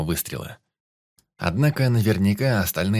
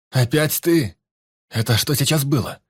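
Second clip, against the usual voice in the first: high, loud alarmed shout; shouting while running; 7 semitones higher, 5 dB louder than usual. A narrator's reading. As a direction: yell it out on the run. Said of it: "кричал он на бегу".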